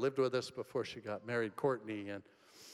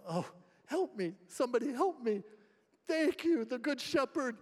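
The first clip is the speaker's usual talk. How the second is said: high, squeaky sheep voice; voicing the rescued sheep; 10 semitones higher than usual. Pastor voicing a sheep rescued by a shepherd, high and squeaky. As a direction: play the stuck sheep, pleading then overjoyed.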